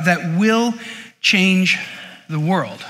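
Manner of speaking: slow, with a pause before "change" and before "the world"; emphatic pauses. Real, not acted. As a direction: land each word separately for weight. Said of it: "I know that's a bold statement".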